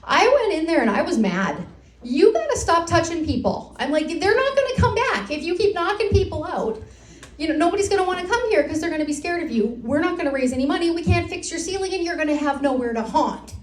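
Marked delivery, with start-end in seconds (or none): 2.0-13.5 raised, higher scolding rant, picking up speed. Retold or imitated. Volunteer guide scolding the ghost in the lockup, ranting. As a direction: tell off the ghost, exasperated. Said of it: "And that was my rant".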